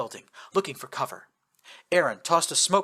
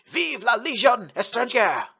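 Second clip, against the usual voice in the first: high, loud triumphant French cheer; 9 semitones higher, 5 dB louder than usual. Acted. Old legionnaire cheering as French helicopters arrive. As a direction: fist in the air, shout it out for the Legion. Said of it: "pumped his fist in the air and cheered".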